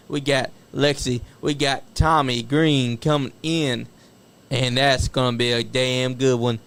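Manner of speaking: loud hype podcast sign-off; hype announcer. Acted. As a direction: pump up the crowd for next week. Loud hype outro for the show.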